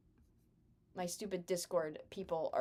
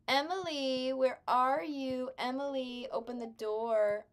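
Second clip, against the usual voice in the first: calling out; 5 semitones higher, slower than usual. Pretend. Calling out to character.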